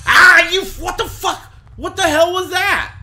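screaming in terror, very high and loud; frightened scream. Real, not acted. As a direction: shriek it out in real panic. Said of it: "don't yell".

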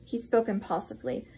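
ordinary delivery, nothing marked

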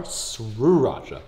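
Sriracha with S and R run together, drawn out; stretched "srrru" opening on Sriracha; exaggerated SR pronunciation. Shown as a demonstration. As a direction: say Sriracha slowly as "sroo-racha". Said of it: "sriracha is S R".

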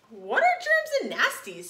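high curious princess voice; cartoon princess. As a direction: ask it like a wide-eyed little girl. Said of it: "said the little princess".

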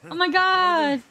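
high, loud exclamation; high-pitched burst of disbelief; excited shock. Real, not acted. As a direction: cry out in amazement. Reunion with game friends.

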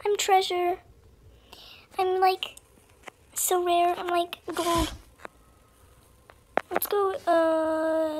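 ordinary talk, nothing marked

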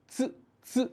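চু said "tsu", t fused with s; tsu demo. Shown as a demonstration. Teacher joins t and s with short u, crisp separate tsu. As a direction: model a short, isolated চু as "tsu". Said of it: "আমাদের ত, স, রস্যুকার একসাথে".

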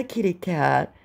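ordinary talk, nothing marked

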